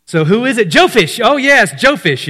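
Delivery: high, loud, excited question and answer; bright-eyed teacher cheering the answer "Jophish"; acted eager quizzing. Acting out the quiz and answer.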